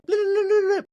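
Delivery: very high, loud chipmunk chatter; rapid-fire high chipmunk blips. Retold or imitated. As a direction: squeak it like a sped-up chipmunk. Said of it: "these chipmunk voices".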